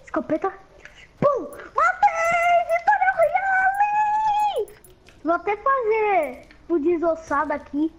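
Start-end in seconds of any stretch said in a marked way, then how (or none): 1.2-4.8 high, drawn-out triumphant yell; excited victory shout. Pretend. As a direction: scream the win out. Kid cheering pretend game win.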